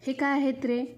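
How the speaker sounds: usual speaking voice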